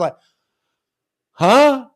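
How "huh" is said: stunned huh. Retold or imitated. Stunned when fish hits bait.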